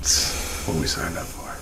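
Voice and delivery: hushed whisper. Real, not acted. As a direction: whisper so nothing hears. Whispering on camera in cellar.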